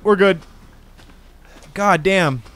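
loud excited relief; pumped up after the fight. Real, not acted. Excited after a big kill.

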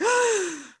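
sharp, loud gasp of horror; mock shocked gasp. Imitating executives hearing her idea, mock shocked gasp. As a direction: gasp as if scandalised.

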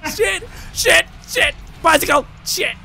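high, loud panicked shouts; frantic cursing. Real, not acted. Player cursing a frantic scramble at goal, frantic shouting. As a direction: yell each word in a separate burst.

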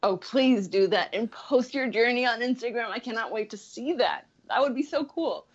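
ordinary talk, nothing marked

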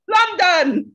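high, loud incredulous question; surprised local. Retold or imitated. Local shocked she's from London, loud high disbelief. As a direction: play the local who can't believe it. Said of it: "they all say".

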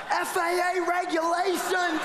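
high, laughing mimic of a fussy steward; effeminate steward. Preacher mimicking the effeminate steward, high laughing imitation. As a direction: camp up the steward's plea. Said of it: "He's a little bit effeminate".

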